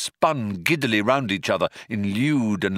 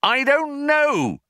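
higher, louder, slow yell; 4 dB louder, slower than usual. Acted. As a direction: yell it back over the noise. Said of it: "yelled Ford".

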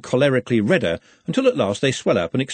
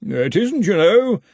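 cheerfully; 9 semitones higher, 5 dB louder, slower than usual. A narrator's reading. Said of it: "said Henry cheerfully".

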